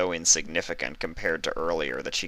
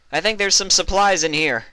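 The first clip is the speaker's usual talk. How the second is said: high, louder voice across the room; Vinyl calling over; 13 semitones higher, 8 dB louder than usual. A narrator's reading. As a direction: call her over eagerly. Vinyl calls from doorway.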